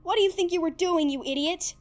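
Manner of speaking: high, loud furious scream at Zim. Acted. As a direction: let it explode at him. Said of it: "Dib pretty much screamed at him".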